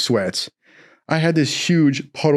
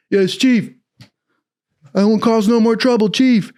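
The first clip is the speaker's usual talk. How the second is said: high, loud sheepish yes chief; meek recruit; 9 semitones higher, 3 dB louder than usual. Retold or imitated. Recruit answering the chief, high and overly obedient. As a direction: grovel up high and a bit loud. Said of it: "I go".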